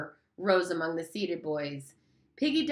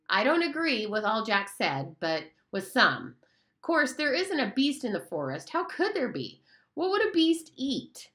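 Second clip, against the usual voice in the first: a touch higher, fussy and reasoning; a hint of a know-it-all schoolboy arguing; lightly voiced earnest fat boy; 7 semitones higher than usual. Piggy reasons with the boys.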